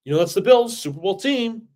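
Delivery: high, loud whiny mock of fans making excuses. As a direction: sneer it out like a defeatist fan. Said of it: "us Jet fans ... are like".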